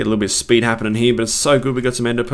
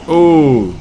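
loud, higher drawn-out oh; near-miss cry; 5 semitones higher, 9 dB louder than usual. Real, not acted. Player just missed the dragon, loud cry.

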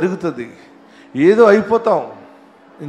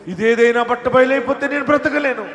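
climactic shout; 8 semitones higher than usual. Acted. Preacher crying out to weeping believers, building shout.

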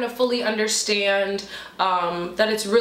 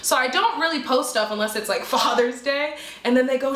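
normal talking voice

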